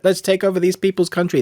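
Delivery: lightly hammed-up coloniser, pitched high; mock righteous conqueror. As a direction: parody an eager empire-builder.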